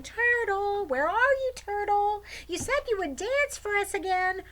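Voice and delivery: very high, loud calling; children calling out. Acted. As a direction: call across the forest for the turtle.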